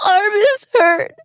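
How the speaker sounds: put-on high, slow, hiccuping child's cry; a small hurt child sobbing. An injured child crying to the teacher, hiccuping sobs. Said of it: "someone hiccup cried".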